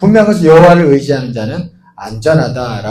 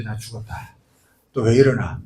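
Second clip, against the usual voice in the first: soft, lower muttered dread; fearful thought; 5 semitones lower, 6 dB quieter than usual. Retold or imitated. Employee dreading the boss's call, soft and low. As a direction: mumble it like you're in trouble.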